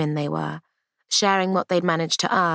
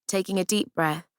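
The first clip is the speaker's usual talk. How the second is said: plain reading voice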